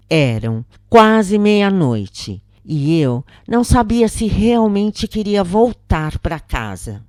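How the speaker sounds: usual speaking voice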